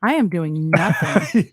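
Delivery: slow, low, over-deliberate denial; drawn-out mock answer. Friend answering what they are doing, slow mock denial. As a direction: stretch out NOTHING, low and deliberate.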